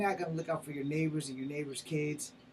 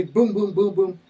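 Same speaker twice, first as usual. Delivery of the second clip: loud, higher-pitched booms in quick succession; mock punches; 4 semitones higher, 11 dB louder than usual. Describing a beating to listeners, loud hammered booms. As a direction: punch out each boom like a blow.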